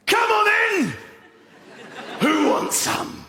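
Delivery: high, straining shout; imitating a football fan's shout; rowdy fan challenge. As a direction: yell a challenge to the whole stand. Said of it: "he stood up and he just went".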